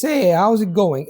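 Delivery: slow greeting in a heavy Italian accent. Shown as a demonstration. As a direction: say it slowly, as an Italian speaking English. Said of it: "if you speak English like this".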